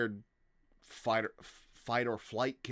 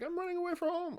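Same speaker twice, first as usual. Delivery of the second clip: put-on high, whiny kid voice, much higher; faster than usual. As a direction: play a naive little kid announcing his plan. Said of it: "a lot of kids is like".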